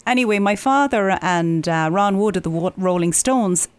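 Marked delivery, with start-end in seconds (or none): none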